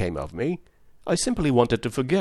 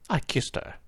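whispered admission; 3 semitones higher, 6 dB quieter than usual. Acted. Shamefaced admission.

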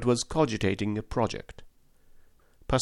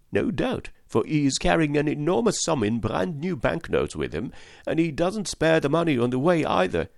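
higher, eager chattering servant voice; excitable French valet; 7 semitones higher than usual. A narrator's reading. Valet telling Fix of Paris, eager higher voice. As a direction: gush like a young valet abroad.

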